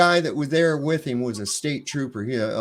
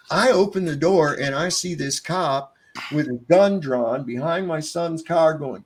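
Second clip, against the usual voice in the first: usual speaking voice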